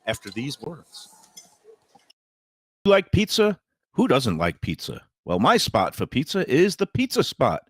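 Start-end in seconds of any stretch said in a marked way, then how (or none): 2.8-7.6 upbeat radio ad voice; ad read. Acted. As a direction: sell it like a radio spot. Ad read for sponsor.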